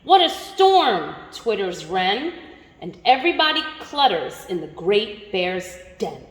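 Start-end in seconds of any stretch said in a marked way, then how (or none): 0.0-1.1 high, excited little mole. Acted. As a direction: exclaim like a small animal. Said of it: "Mole mutters".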